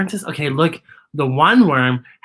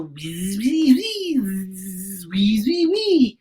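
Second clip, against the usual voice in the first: buzzing bees; much slower than usual. A narrator's reading. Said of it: "They go".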